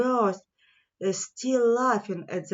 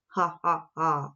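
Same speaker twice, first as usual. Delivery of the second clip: lower, spoken mock laugh; mocking laughter; 4 semitones lower than usual. Lions laughing at the little mouse, low mock laugh. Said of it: "All the lions fell about laughing".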